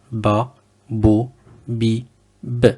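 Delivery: ba, bo, bi, b, each syllable sounded out crisply; syllable drill. Shown as a demonstration. Teacher drills the syllables for learners. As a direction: model ba, bo, bi, b one by one. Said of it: "on répète".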